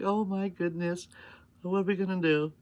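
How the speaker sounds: hammed-up alarm; mock dismay. Mom reacting to the forgotten real cake, high hammed-up dismay. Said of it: "I was like".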